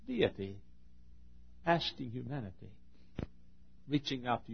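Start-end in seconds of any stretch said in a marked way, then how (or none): none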